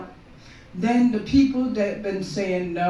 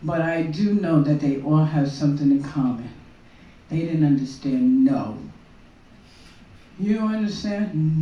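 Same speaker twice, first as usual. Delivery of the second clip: ordinary delivery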